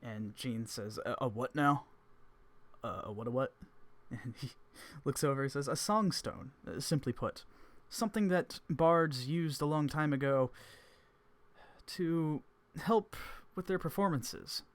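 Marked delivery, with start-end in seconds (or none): 1.1-3.5 loud, baffled repeat; a bard caught off guard; much louder bewildered question; confused Gene. Acted. 5.7-14.6 higher, louder, slow storyteller sage; old sage recounting bardic legend; Evans voice. Acted.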